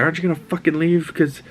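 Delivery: put-on high-pitched teen girl snapping back; angry Ellie. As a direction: pitch it way up and spit it out. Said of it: "she's like".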